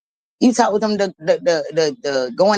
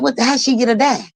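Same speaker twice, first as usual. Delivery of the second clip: higher, incredulous retelling; astonished question; 8 semitones higher than usual. Retold or imitated. Teller asking the father about the hair dye, retold incredulity. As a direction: ask it in disbelief.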